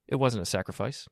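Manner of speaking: ordinary delivery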